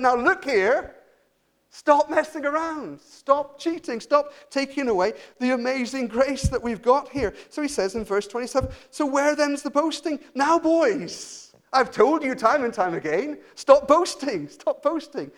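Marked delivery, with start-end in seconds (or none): 0.0-7.3 high, forceful scolding; stern telling-off. Acted. God voiced scolding like the referee. 8.9-15.3 high, loud, emphatic scolding; exasperated telling-off. Acted. Paul voiced as scolding referee.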